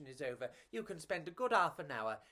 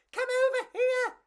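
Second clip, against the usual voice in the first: very high, louder call; tiny bug voice; 8 dB louder than usual. Acted. Pedro calling Enrico through the crowds, very high call. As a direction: call your friend over, squeaky. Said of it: "Pedro said".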